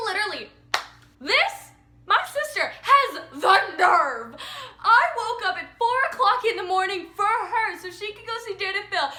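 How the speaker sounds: high, loud exasperated outrage; indignant rant. Real, not acted. Ranting about her sister's nerve, indignant. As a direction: punch out each grievance, high and loud.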